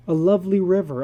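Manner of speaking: usual speaking voice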